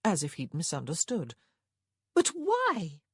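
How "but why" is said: high, louder exclamation; crying out. A narrator's reading. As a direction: cry it out in protest. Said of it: "her sister cried".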